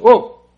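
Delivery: loud sharp oh; startled horse cry. Retold or imitated. Skittish horse spooks at a barking dog, loud mock jolt.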